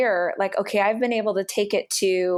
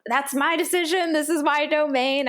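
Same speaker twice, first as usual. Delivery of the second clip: a touch of possessive, higher; pitched-up touchy protest; fussy; 7 semitones higher than usual. Retold or imitated. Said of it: "fussy about".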